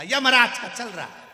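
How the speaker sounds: furious loud retort, pitched high; angry shout. Retold or imitated. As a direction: bark the answer in rage. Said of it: "अब इसको आ गई गुस्सा". (गुस्से में चिल्लाकर जवाब)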